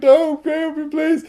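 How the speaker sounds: high, pleading cry for help; pleading scared. Retold or imitated. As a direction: beg like a terrified victim. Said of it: "he drops the scalpel like".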